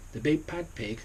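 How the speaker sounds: usual speaking voice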